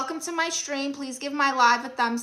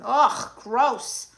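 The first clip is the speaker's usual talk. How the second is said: disgusted; 5 dB louder than usual. Real, not acted. Reacting to creepy viewer comment.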